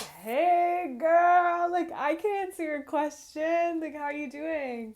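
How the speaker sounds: put-on high, loud character voice with a drawn-out "Hey, girl"; put-on breezy greeting. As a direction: play an over-the-top, chirpy greeter. Acts out Lupin's breezy greeting.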